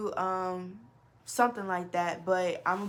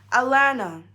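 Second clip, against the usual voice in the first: loud answer; 4 semitones higher, 11 dB louder than usual. Retold or imitated. Driver answers her question.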